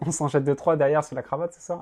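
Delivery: ordinary talk, nothing marked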